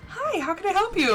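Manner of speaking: high, chipper phone-greeting voice; customer service voice. Shown as a demonstration. Answering a customer's call, chipper and high. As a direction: answer the phone like the helpful shop clerk. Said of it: "I have a customer service voice".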